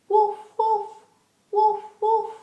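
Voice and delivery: high, loud pretend woofs; doggy bark. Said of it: "The doggy says".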